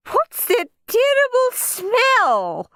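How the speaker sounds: very high, drawn-out horror; revolted guest wailing over a stench; choked disgust. A narrator's reading. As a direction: choke it out, slow and high-pitched. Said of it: "choked Missus Mossy".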